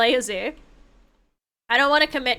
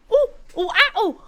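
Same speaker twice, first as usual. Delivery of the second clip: higher, playful monkey hoots; monkey noises; 9 semitones higher than usual. Retold or imitated. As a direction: hoot like a monkey to tease it. Mimicking the monkey.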